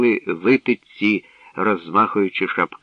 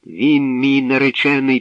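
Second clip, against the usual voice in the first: defiant; 5 semitones higher, 5 dB louder than usual. A narrator's reading. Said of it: "тупнула ногою Морося".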